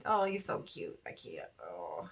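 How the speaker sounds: soft cooing at a cute kitten. Real, not acted. Vlogger cooing at a kitten video, soft and tender. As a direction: melt over a kitten.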